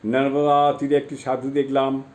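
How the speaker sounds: usual speaking voice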